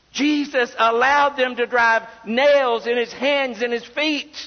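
fervent pulpit voice; impassioned preaching. Real, not acted. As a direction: preach it with rising urgency, pressing each point home. Preaches at full force.

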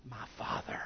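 near-whispered, low and tender address; hushed, very soft and low; intimate relation. Acted. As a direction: whisper it to a father, close. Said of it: "to a point of relation".

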